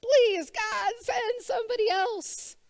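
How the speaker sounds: high, pleading plea to be let off; whining Moses. Retold or imitated. Preacher voicing Moses begging God to send another, high and pleading. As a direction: beg God to send someone else, voice up high. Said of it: "Moses saying".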